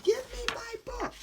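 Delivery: put-on high demanding kid voice; little boy voice. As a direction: demand it back like a small boy. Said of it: "he's probably saying".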